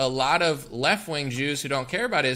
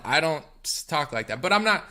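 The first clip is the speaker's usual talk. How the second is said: usual speaking voice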